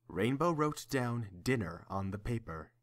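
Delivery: ordinary talk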